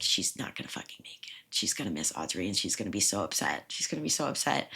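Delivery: soft unvoiced whisper, as if thinking it privately; secret thought whispered. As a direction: breathe it out like a hidden worry. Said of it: "in the back of her head".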